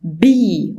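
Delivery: بي with a lip burst and long ee; letter B. Shown as a demonstration. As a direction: say B the American way. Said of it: "تاني letter عندي اسمه بي".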